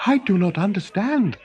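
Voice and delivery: high, puzzled king's voice. Acted. Noggin asking Olaf, high and puzzled.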